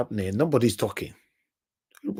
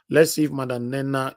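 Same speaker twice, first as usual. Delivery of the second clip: ordinary talk, nothing marked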